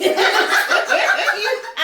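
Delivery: bursting into high laughter; a loud high laugh. Real, not acted. Laughs after husband quote.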